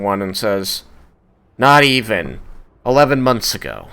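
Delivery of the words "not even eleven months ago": louder, higher curt correction; lightly voiced clerk correcting firmly; tiefling clerk. Tiefling reading the ledger, correcting the dwarf firmly.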